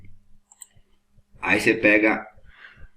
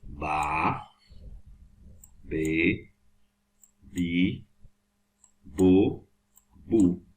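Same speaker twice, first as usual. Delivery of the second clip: ba be bi bo bu spaced out slowly; slow syllable drill; 4 semitones lower, 4 dB quieter, much slower than usual. Shown as a demonstration. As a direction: count out ba be bi bo bu one by one. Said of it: "Tá vendo?". (ba be bi bo bu bem pausado)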